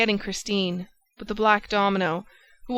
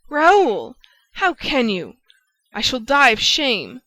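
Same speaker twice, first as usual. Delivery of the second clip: anguished cry; 5 semitones higher, 8 dB louder than usual. A narrator's reading. Christine cries out, wounded.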